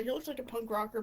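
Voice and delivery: ordinary talk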